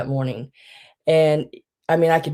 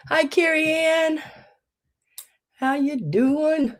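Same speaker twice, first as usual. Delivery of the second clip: cheery greeting; 11 semitones higher, slower than usual. Real, not acted. Greeting viewer.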